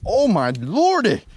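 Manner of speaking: amazed exclamation. Real, not acted. Feeder frenzy surprises the angler.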